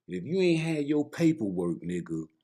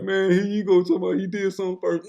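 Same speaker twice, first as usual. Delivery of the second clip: higher, louder teasing voice of a doubting viewer; mock viewer; 7 semitones higher, 6 dB louder than usual. Imitating viewers mocking his claim of doing it first, higher and louder. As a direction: play the eye-rolling viewer. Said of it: "You like".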